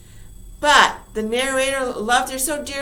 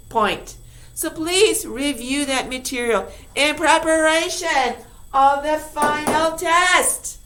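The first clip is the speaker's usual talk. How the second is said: ordinary delivery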